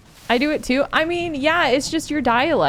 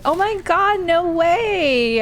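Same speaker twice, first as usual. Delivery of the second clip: put-on much higher, gushing Valley Girl excitement; Valley Girl "oh my God, no way" pitched way up; excited Valley Girl; 9 semitones higher, much slower than usual. Shown as a demonstration. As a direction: squeal it like a thrilled Valley Girl. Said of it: "When I get excited".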